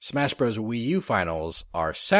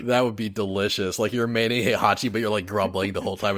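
normal talking voice

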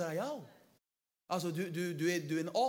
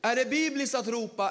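ordinary talk

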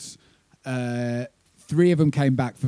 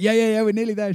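quick reassurance; 9 semitones higher, 4 dB louder, faster than usual. Retold or imitated. First answer to ferry lady.

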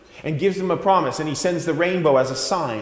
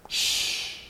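hush; 10 dB quieter than usual. Acted. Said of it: "said the beaver".

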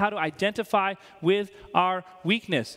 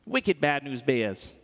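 slow, lower New England accent with dropped r; 6 semitones lower, slower than usual. Shown as a demonstration. Preacher voicing religious leaders, in a New England accent. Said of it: "I always try to do a New England accent".

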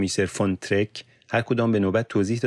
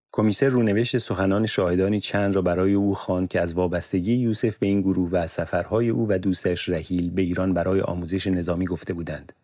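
plain reading voice

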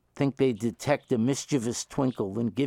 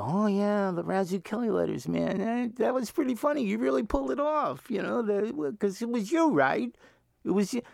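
lightly voiced smug fan, pitched up; knowing fan; 10 semitones higher than usual. Fan cornering Vin with a knowing nudge, light mock voice. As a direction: play the fan who is sure he's in on it. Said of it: "people meet me somewhere and they go".

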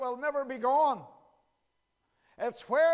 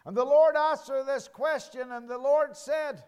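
rising, ringing pulpit preaching; 4 dB louder than usual. Acted. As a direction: preach it out over the congregation. Preaching sermon.

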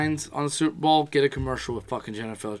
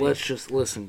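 ordinary talk, nothing marked

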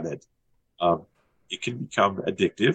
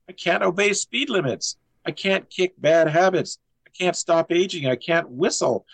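measured list-poem recitation, higher and deliberate; poem reading; 8 semitones higher than usual. Acted. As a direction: recite each line as its own beat. Reads his poem aloud.

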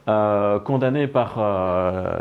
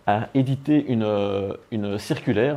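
usual speaking voice

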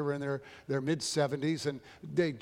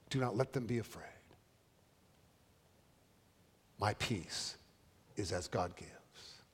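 soft benediction; 4 semitones lower, 6 dB quieter than usual. Acted. Quoting Jesus' closing words softly.

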